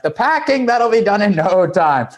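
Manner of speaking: breezy, high-pitched overruling; dismissive reassurance. Retold or imitated. Wingman waving away friend's packing excuse, dismissive. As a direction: brush it off, pitched well up.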